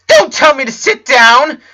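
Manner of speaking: put-on scared boy shouting at his friend; frantic shout. A narrator's reading. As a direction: snap back in a panic. Clyde refuses, panicking.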